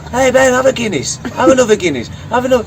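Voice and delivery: high, loud mock urging; mimicked pushy friends. Friends plying Ben with Guinness, mock urging.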